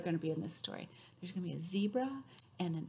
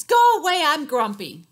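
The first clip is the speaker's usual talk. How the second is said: loud grumpy shout; grumpy yell; 5 semitones higher, 14 dB louder than usual. Acted. Zebra yelling at Elephant, loud grumpy shout. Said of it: "said Zebra".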